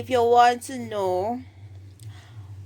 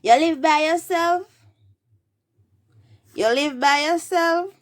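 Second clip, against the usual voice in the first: high, loud Jamaican Patois question; 8 semitones higher, 6 dB louder than usual. Shown as a demonstration. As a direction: ask in Patois with pitch climbing. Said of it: "in patois".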